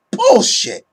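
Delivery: high, loud scoff of rejection; loud dismissive yell; emphatic shout. Real, not acted. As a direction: shout it down like nonsense. Rejecting a fan opinion.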